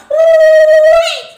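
stretched shrill-high squeal of delight; long high squeal. Acted. Admiring herself in the mirror, long high squeal.

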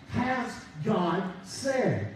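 slow, drawn-out doubting question; the preacher voicing Satan's tempting question to man, slowly; Satan's sly question. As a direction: stretch each word to sow doubt. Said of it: "he uses against man".

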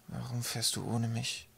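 soft, slow, hurt whisper. A narrator's reading. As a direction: whisper it sorrowfully to an absent father. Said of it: "flüsterte er".

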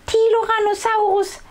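put-on very high babbling baby dino voice. A narrator's reading. Baby sister begging big brother to cuddle, high babble. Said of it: "brabbelt Tienchen".

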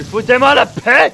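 high, very loud drawn-out yell; exasperated shout. Real, not acted. Player fending off game enemies, exasperated shout. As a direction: shout them away, stretching the words.

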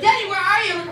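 high mock motherly call; mom calling. Mom looks for her kid in the tubes, calling high. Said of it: "My mom would be like".

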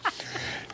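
hysterical laugh. Real, not acted. Laughs at paid advice.

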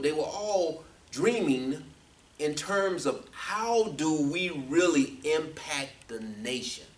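forceful preaching with stretched key words; emphatic preaching. Real, not acted. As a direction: drive each phrase home to the congregation.

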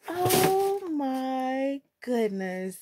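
very slow, stretched-out amazement; drawn-out awe. Real, not acted. Vlogger savouring her new shoes, slow drawn-out awe. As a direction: stretch every word in wonder.

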